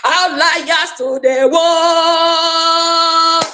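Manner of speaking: slow, high, drawn-out announcement; proud proclamation. Real, not acted. Hailing her cohort to the world, slow and high proudly. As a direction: proclaim it like a banner headline.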